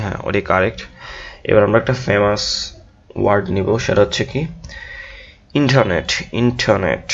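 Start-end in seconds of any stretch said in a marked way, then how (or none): 5.6-7.1 ইন্টারনেট as "IN-tuh-net", no R; weak middle vowel in ইন্টারনেট; English pronunciation. Shown as a demonstration. Teacher introducing a famous word, R-less ইন্টারনেট. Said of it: "আচ্ছা, ইন্টারনেট".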